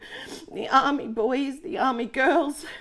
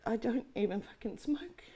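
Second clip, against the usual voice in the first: usual speaking voice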